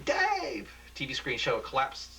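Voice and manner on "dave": very high scream of his name; co-anchor screams as the building falls. Acted. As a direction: shriek his name in terror. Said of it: "Michelle screaming".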